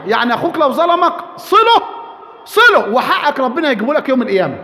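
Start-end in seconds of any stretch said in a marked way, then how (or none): 1.4-2.9 urgent shout. Real, not acted. Preacher urging forgiveness of wronging brother.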